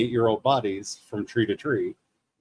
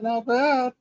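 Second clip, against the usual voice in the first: higher pleased repeat; 13 semitones higher than usual. Real, not acted. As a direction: sound pleased with yourself.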